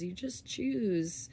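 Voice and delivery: usual speaking voice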